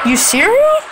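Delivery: high, loud disbelieving rise; high astonished question; incredulous. Real, not acted. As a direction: sound amazed they let you win. Shocked opponent lets him win.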